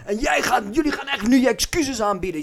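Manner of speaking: high, loud angry rant; flipping out, imitated. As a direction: tear into them, re-enacting a comrade's outburst. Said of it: "Dus Luc flipt helemaal uit".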